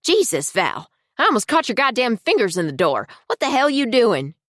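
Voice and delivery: sharp, high furious outburst. A narrator's reading. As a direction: snap at her, high and loud.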